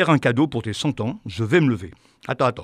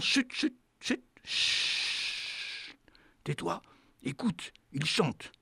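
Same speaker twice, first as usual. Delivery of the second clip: hissed shushing dropping to a whisper-quiet voice; hushed; 3 semitones higher, 10 dB quieter, much slower than usual. Acted. Hushing his partner to listen to someone singing, very quiet. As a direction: hush him so they can hear the singing.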